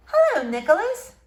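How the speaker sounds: high, bright-voiced teacher greeting. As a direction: sing out a teacher's hello.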